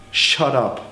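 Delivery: normal talking voice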